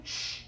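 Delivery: soft drawn-out "shh"; quiet shushing breath. Acted. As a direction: hush the wind gently. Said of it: "He's saying".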